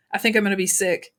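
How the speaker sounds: plain reading voice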